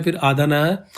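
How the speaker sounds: ordinary delivery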